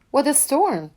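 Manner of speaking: high chirpy bird voice; twittering wren. A narrator's reading. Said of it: "twitters wren".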